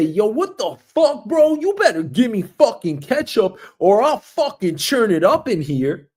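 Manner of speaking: hammed-up furious New Yorker; mock angry cursing. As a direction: pretend to chew out the deli guy. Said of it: "not gonna curse at this deli guy".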